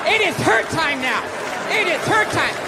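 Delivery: high shouted mock outrage; mock angry feminist. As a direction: shriek it at him like an outraged mob. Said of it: "they're on me".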